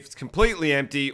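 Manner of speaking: ordinary talk, nothing marked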